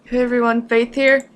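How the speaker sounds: ordinary talk, nothing marked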